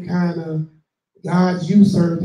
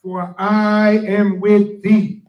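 slow, loud, higher proclamation of scripture; scripture reading; 3 semitones higher, 7 dB louder, much slower than usual. Acted. Reading Isaiah to the congregation, slow and loud. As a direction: let each word ring out slowly.

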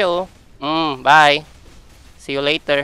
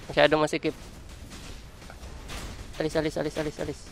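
muttered shooing; 12 dB quieter, faster than usual. Real, not acted. Shooing enemies from his spot.

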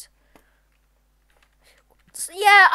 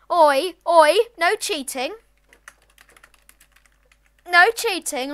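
telling off; 5 semitones higher, 10 dB louder, slower than usual. Real, not acted. Scolding a cheating player.

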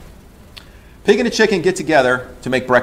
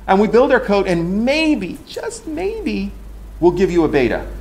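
sarcastic teasing; 6 semitones higher than usual. Retold or imitated. Sarcastic aside about beta releases.

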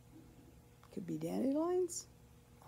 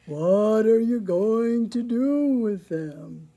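loud, slow cow voice; storybook cow with drawn-out vowels; mooing cow; 9 dB louder, slower than usual. A narrator's reading. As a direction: moo the question out, long and slow. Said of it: "she mooed".

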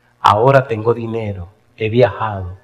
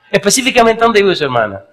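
higher, louder questioning lady; curious lady; 9 semitones higher, 5 dB louder than usual. Retold or imitated. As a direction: press him like a nosy lady, pitched up. (señora preguntona, voz más aguda)

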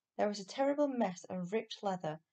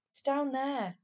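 put-on higher-pitched, insistent boy; 6 semitones higher, 3 dB louder, slower than usual. A narrator's reading. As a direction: insist on it to the doubting policeman. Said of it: "Barney insisted".

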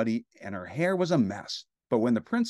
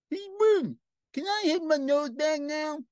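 very high, squeezed little voice; high-pitched comic plea; squeaky character voice. A narrator's reading. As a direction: pipe it up in a tiny squeak. Puppet character's line, squeaky voice.

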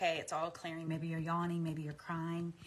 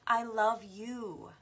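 slow loud declaration; 4 semitones higher, 8 dB louder, much slower than usual. Acted. Self-love affirmation.

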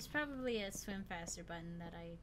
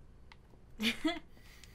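laughing; 8 semitones higher, 4 dB louder than usual. Real, not acted. Laughing at own joke.

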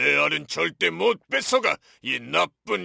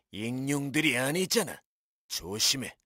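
lower, softer careful warning; low cautious warning; 7 semitones lower, 7 dB quieter than usual. Acted. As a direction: warn under your breath. Dinosaur warns quietly.

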